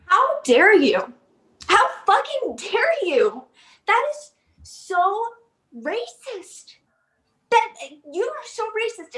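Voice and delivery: high, slow, hammed-up indignation; theatrical offended gasping outrage; mock outrage. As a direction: clutch your pearls and act scandalized. Outraged reaction to insult.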